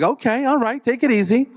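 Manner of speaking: high-pitched mock placating; defensive backing off. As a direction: back down in a high, squeaky protest. Backs off after nurse refuses.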